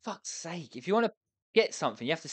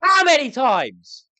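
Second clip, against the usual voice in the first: high, loud furious shout; raging at an unresponsive game; real angry yelling; 9 semitones higher, 7 dB louder, slower than usual. Real, not acted. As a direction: bellow it in exasperation. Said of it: "I need to save my anger".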